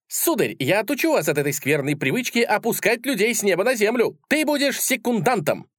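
high, indignant duellist; mock-heroic challenge. A narrator's reading. Idealist draws his sword on the sceptic, indignant challenge. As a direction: declaim it high, challenging him to a duel.

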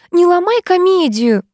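high, drawn-out hysterical squeal; acted shrill outburst; shrieking. A narrator's reading. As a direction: screech it in hysterics. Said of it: "заверещала Вита".

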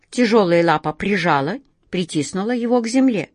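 ordinary delivery

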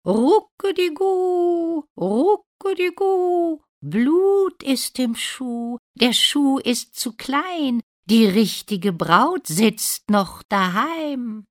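very high, slow, loud cooing chant; calling doves. A narrator's reading. Doves warn the prince of blood in the shoe, calling high. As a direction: call out like doves, stretching every vowel. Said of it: "Da rufen die Tauben".

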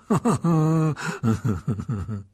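scornful falling laugh; mocking laugh. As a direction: laugh off the absurd idea. Malfoy laughs at Potter idea.

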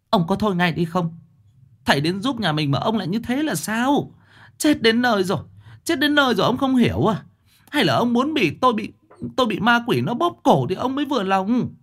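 wife berating husband in terror; frantic scolding. Acted. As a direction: scold him in desperate panic.

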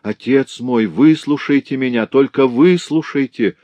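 cling to him and beg; despairing plea. A narrator's reading. Louise on her knees begging her father to listen, despairing cry. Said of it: "закричала с отчаянием и мольбой".

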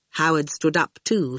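ordinary delivery

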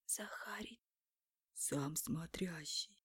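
hushed whisper of the crowd. Acted. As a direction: whisper it, barely voiced. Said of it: "Слышалось отовсюду перешёптывание".

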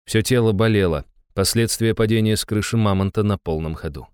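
ordinary delivery, nothing marked

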